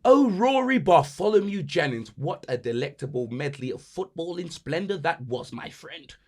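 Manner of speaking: a lofty pundit savouring the goal; posh commentator. Pretend. Mock posh football commentary.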